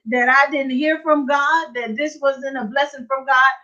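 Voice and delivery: usual speaking voice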